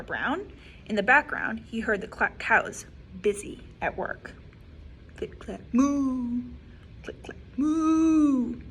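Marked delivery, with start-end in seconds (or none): none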